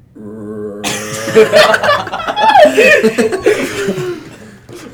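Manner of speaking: long, loud, high zombie groan. Acted. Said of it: "Can you guys make the zombie noise from Minecraft".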